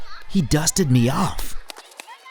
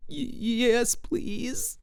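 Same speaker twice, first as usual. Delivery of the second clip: higher, faint stammered yes; shy stammer; 11 semitones higher, 6 dB quieter, much slower than usual. Acted. A shy bean accepting a hand, faint and higher. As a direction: stammer it out shyly.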